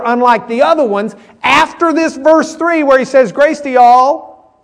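loud, high preaching voice; full-force preaching. Acted. Pastor preaching to his class on y'all, loud and high. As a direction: preach it out to the whole room.

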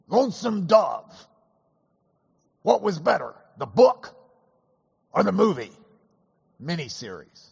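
hint of the justice posing his question to the table; Nino Scalia impression. Recalling Scalia quizzing the hunting party, lightly imitated. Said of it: "I'm gonna do my imitation as best as I can".

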